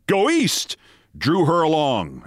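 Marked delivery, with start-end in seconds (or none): 0.1-0.8 high shouted cry; crowd shouting. A narrator's reading. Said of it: "the seething throngs shouting".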